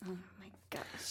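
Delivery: soft breathy whisper. Real, not acted. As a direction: whisper it in disbelief. Reacting to refusal to answer.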